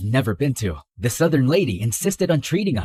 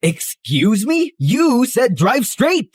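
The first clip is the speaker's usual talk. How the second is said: indignant shout; 7 semitones higher, 3 dB louder, slower than usual. Acted. Protesting in car chase.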